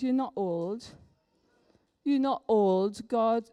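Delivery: ordinary talk, nothing marked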